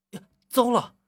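sudden loud, high cry of dismay; startled shout, pitch leaping up; alarmed exclamation. Shown as a demonstration. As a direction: burst out as if just remembering something forgotten. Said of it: "这里开始惊呼".

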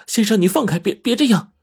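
high, flustered pleading; urgent cry. A narrator's reading. Xiaoqin unable to break free, urgent cry. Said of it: "就急忙的喊着说".

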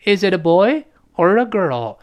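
put-on "boy" rises, "girl" falls; choice-question intonation. Shown as a demonstration. Teacher modelling a choice question, up on "boy", down on "girl". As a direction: lift the tune on "boy", drop it on "girl". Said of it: "选择项是升调".

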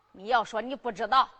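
usual speaking voice

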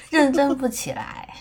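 giggling through the words; can't stop laughing while talking. Real, not acted.

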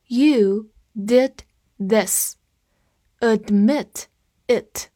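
each word separate, slowly, for learners; slow read-along. Shown as a demonstration. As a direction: read it slowly, pausing between the words. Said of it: "跟着我慢读一遍". (慢速跟读，逐词停顿)

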